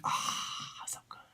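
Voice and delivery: breath-only gush, fading to nearly nothing; overcome whisper. Real, not acted. Fan overcome by people doing good work, whispering. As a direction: whisper it, swooning with admiration.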